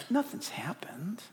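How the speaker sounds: soft coaxing whisper; tempter's whisper. Acted. Satan reassuring the sinner, soft coaxing whisper. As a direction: whisper it like a secret excuse.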